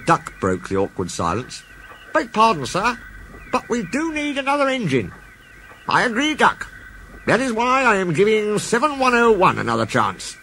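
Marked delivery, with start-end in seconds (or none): 2.1-5.1 a much higher, polite engine voice; a respectful engine breaking the silence; Duck's voice. Acted. Duck politely tells the Fat Controller they need an engine. 5.9-10.3 a much higher, measured boss voice; a boss settling the matter; Fat Controller's voice. Acted. The Fat Controller answers Duck, measured.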